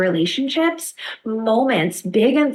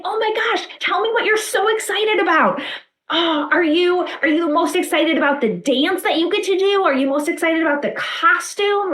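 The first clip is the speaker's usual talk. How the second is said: much higher, bubbly excited voice; excited and interested; 8 semitones higher than usual. Shown as a demonstration. Parent gushing over the child's school play role, excited and high. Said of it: "Attunement would be saying".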